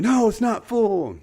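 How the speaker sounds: high, loud, drawn-out chorus answer; slow, excited group shout; whole class joining in. Retold or imitated. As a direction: call it out like an eager class. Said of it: "they all kinda join in".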